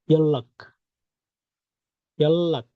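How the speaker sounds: ইয়াল্লাক with a long ll, yal-lak; Korean word pronounced. Shown as a demonstration. Teacher models the word for students. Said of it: "এটার উচ্চারণ হয়ে যাবে".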